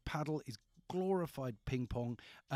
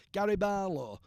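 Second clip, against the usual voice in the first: [name] impression; 6 semitones higher, 7 dB louder than usual. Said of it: "That's how he talks in England".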